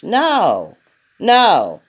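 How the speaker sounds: normal talking voice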